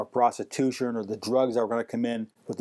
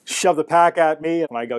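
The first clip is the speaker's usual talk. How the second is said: usual speaking voice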